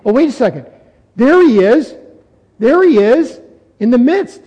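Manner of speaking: an onlooker's excited cry; crowd spotting someone. Acted. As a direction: call it out as a sudden sighting. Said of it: "the crowd is being".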